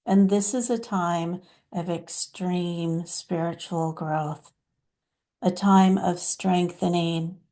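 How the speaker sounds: lightly voiced channeled mother, deliberate and pausing; hint of a solemn mother, phrase by phrase; Divine Mother. Mother addressing her dear children about turmoil, lightly voiced divine guide. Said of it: "let's hear what Divine Mother has to say".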